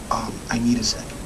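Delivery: imitating the chef's faint whisper; retold whisper. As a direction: breathe it out, barely voiced, quiet. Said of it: "He says".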